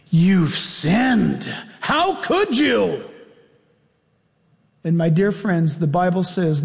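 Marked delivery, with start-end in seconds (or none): 0.1-3.0 mock-horrified accusation. Satan condemning the believer he led astray, acted horrified reproach. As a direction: rise into a slow, appalled accusation.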